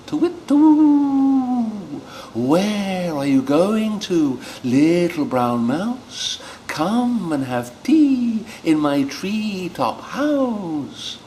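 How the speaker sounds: slow, hooting owl to the little mouse. Acted. Owl inviting the mouse to tea, slow hooting voice. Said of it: "what's an owl voice?".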